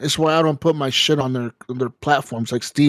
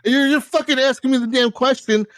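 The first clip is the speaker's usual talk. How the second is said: raised higher, fast, exasperated ranting at the support guy; retold angry rant; 7 semitones higher than usual. Retelling yelling at the EA support agent, fast and exasperated. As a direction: fire it off faster, disbelieving and fed up. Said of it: "I was so fucking mad".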